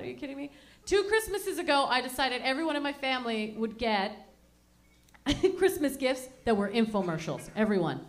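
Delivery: normal talking voice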